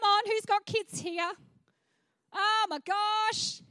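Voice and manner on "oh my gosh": exasperated. Real, not acted. Exasperation about kids.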